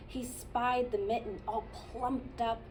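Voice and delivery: plain reading voice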